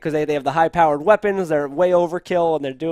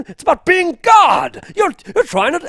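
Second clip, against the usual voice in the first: pretend yelling; 11 semitones higher, 8 dB louder than usual. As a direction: bellow it at a subordinate. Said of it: "is actually yelling at somebody".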